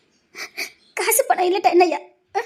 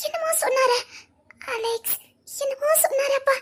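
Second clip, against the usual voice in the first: tearful; 7 semitones higher, 3 dB quieter than usual. Acted. Tearful talk after crisis.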